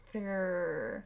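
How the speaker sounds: Through with tongue between the teeth, drawn out; slow model of Through. Shown as a demonstration. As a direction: stretch the TH in Through. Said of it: "put your tongue through your teeth".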